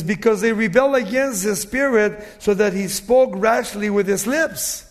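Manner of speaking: loud, emphatic verse reading; forceful preaching. Acted. Preacher reads of Moses speaking rashly, forceful preaching. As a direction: drive the verse home with full projection.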